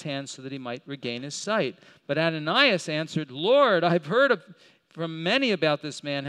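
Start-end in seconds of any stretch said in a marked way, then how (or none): 3.3-6.3 urgent protest. Acted. Ananias protests to God.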